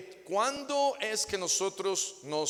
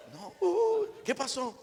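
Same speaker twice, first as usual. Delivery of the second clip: mock startle; 12 semitones higher than usual. Husband startled reply.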